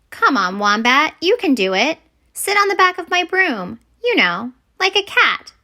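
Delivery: coaxing encouragement. Acted. Wilma coaxes wombat onto broom.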